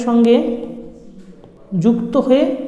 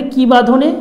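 কি বাঁধনে said louder, pitch raised; emphatic highlight; 3 semitones higher, 6 dB louder than usual. Shown as a demonstration. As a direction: punch কি বাঁধনে to point it out. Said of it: "এই যে আমি mark করেও দিয়েছি".

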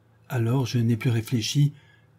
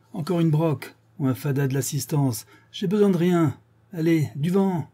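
higher-pitched, exasperated send-off; grumpy, impatient brush-off; irritated dismissal; 7 semitones higher than usual. Acted. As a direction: shoo away an unwanted visitor. Father sends the visitor packing.

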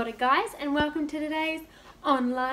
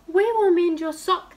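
higher and louder helpers; eager gardeners; 6 semitones higher, 8 dB louder than usual. Acted. Royal gardeners eagerly promising the king, high and loud. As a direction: promise it brightly and keen.

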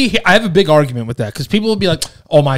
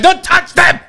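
mock shrill mom yelling, much higher; imitating mom; 14 semitones higher than usual. Quoting his hoarder mom, high mock scolding. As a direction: snap like a protective mom guarding her magazines. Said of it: "She'd be like".

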